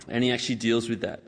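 normal talking voice

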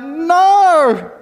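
high, loud shouted "No!"; yelled no. Retold or imitated. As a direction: shout back from the boat. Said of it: "they yell out".

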